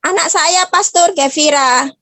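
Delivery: usual speaking voice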